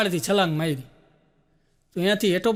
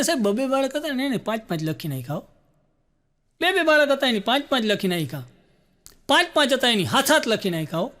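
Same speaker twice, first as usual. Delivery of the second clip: usual speaking voice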